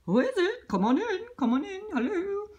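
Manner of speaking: high principal voice. Acted. Principal answering door.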